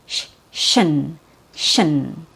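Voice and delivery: "shin" begun with a clear SH; SH sound. Shown as a demonstration. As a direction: isolate the SH, then say "shin". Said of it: "The second T has an S H sound".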